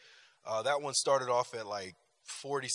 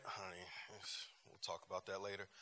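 much softer, low, quick aside; quiet aside; 5 semitones lower, 15 dB quieter, faster than usual. Real, not acted. Speaker putting off a topic with honey, hushed aside. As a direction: drop the voice and brush it off. Aside to spouse mid-talk.

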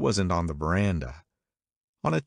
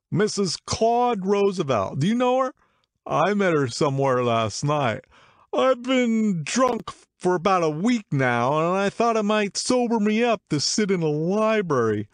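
put-on excited drunk man; 12 semitones higher, 4 dB louder than usual. A narrator's reading. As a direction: prattle proudly like a drunk.

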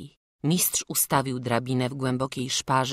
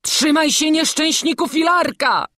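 high, loud fearful shout; old master's anxious shout; frightened cry; 13 semitones higher, 10 dB louder than usual. A narrator's reading. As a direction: cry out in terror for the boy. Master cries out in fear.

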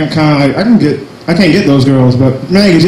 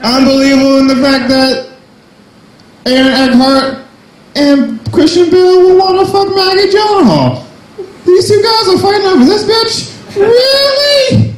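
high comic voice; 13 semitones higher than usual. Pretend. Voicing the Batman bit.

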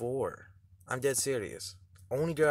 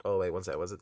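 usual speaking voice

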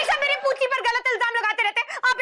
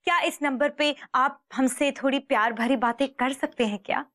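disguised woman's voice; 6 semitones lower than usual. Acted. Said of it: "पराई लड़की बन के बात कर".

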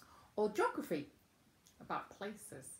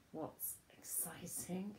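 breathy secret whisper, slowed down; 6 dB quieter, slower than usual. Acted. Teacher asking children about school.

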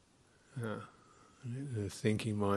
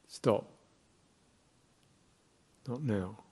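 brief, firm self-command; short, clear, unwavering. Shown as a demonstration. Meditation teacher models halting stray thoughts, short and unwavering. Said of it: "short, clear, unwavering".

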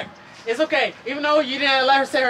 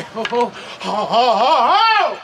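hearty laugh; 5 dB louder, much slower than usual. Real, not acted. Director laughing big mock laugh.

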